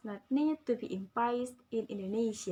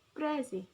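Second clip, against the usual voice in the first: "Crazy" with emphasis on CRA; stress on first syllable; 2 semitones higher than usual. Shown as a demonstration. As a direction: model "Crazy", first syllable leading and second falling. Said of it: "The stress at the beginning of a syllable".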